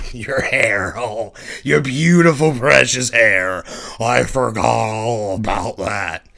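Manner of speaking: sarcastic mockery. Mirror self mocking Mars's hair, loud sarcasm. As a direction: gush sarcastically.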